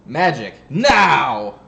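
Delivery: loud, drawn-out announcer-style shout; exclaimed name. Shown as a demonstration. Announcing a favourite player's name to friends, exclaimed. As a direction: belt out the name with a big exclamation mark. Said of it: "I didn't just say, I didn't just say it like that".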